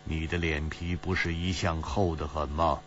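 low, soft, easygoing tease; leisurely, unruffled. A narrator's reading. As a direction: needle him calmly in a low, soft voice. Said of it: "齐冰燕悠然说".

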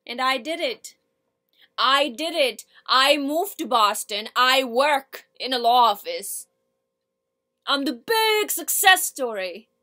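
loud, emphatic boast with a bitter edge; monologue character, defiant. Acted. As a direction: proclaim your success loudly to the room.

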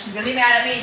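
usual speaking voice

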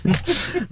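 high squeal of laughter. Real, not acted. Laughing at own story.

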